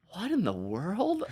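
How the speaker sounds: high, slow, puzzled and a bit hushed; baffled. Retold or imitated. Author recalling first hearing of a blog tour, bewildered. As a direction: drag it out, truly lost by the idea. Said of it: "I'm like".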